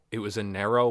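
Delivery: ordinary delivery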